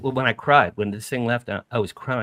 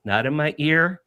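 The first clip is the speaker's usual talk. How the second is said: ordinary talk, nothing marked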